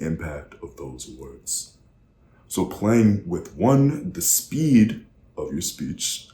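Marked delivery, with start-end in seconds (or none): 2.5-6.3 very slow and deliberate. Shown as a demonstration. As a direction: drag each word out. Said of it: "slow down".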